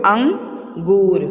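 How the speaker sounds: अंगूर drawn out very slowly for repeating; slow model word. Shown as a demonstration. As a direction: model अंगूर for kids to echo. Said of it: "मेरे पीछे पीछे बोलो".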